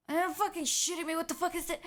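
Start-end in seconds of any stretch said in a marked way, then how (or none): none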